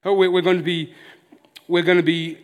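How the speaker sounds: normal talking voice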